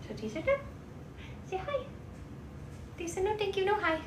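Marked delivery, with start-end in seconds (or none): 1.4-4.0 light pet talk. Real, not acted. Talking for kittens.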